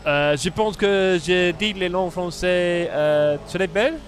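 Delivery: slow, halting amateur French accent; butchered French. Shown as a demonstration. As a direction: feel your way slowly through schoolbook French. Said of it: "I've butchered it there".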